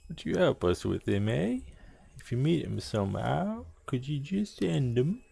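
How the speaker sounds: badly faked British with swooping question endings; British accent. Gang boss asking for help, hammy British accent. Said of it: "I hate doing accents".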